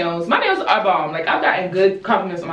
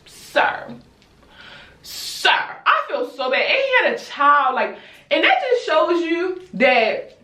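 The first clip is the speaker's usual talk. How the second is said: ordinary talk, nothing marked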